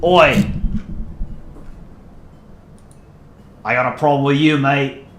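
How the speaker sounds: badly faked Australian accent; Aussie accent. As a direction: pick a fight in a mock Aussie accent. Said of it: "Do an Aussie accent?".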